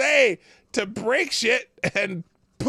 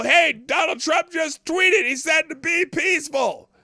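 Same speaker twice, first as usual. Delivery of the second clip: retold yelling; 6 semitones higher, 4 dB louder than usual. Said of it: "yelling at people".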